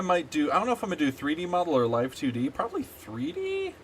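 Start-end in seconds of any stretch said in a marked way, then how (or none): 2.5-3.7 unsure high question. Real, not acted. Unsure musing.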